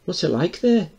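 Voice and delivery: put-on high curious girl voice; young girl voice. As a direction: ask like a curious little girl. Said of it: "Donna Marie".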